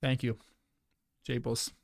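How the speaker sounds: chewing while talking; mouth full. Real, not acted. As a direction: talk through a mouthful of apple. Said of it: "sorry for the chewing".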